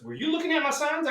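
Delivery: high, quick questioning voice; catcher asking. Retold or imitated. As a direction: catch him out, fast and high. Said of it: "And Gary goes".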